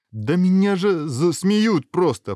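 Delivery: high, loud, drawn-out complaint; plaintive protest. A narrator's reading. Hero fearing mockery back home, loud plaintive protest.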